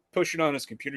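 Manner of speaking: ordinary delivery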